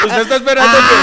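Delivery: loud, high shout through laughter; laughing protest. Real, not acted. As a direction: protest at full volume, cracking up. Se defiende riendo, a gritos.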